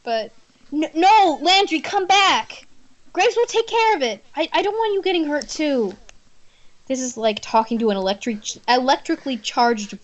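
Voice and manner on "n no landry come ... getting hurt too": high, urgent heroine begging him to stay; pleading heroine. Acted. Heroine pleads with Landry not to chase the attacker, high and urgent. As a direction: beg him not to run off.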